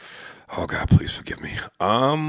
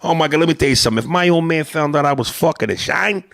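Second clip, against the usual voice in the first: put-on animated old-school Italian-American accent; Italian-American neighborhood tough talking to his pal; Italian-American guy; 3 semitones higher than usual. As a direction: argue like an Italian-American wiseguy. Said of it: "Italians that mean no harm".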